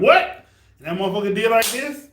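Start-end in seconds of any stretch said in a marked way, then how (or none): 0.0-0.4 loud, high-jumping "what"; startled. Retold or imitated. Retelling their reaction to the fight, startled. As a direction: blurt it out, sharply rising.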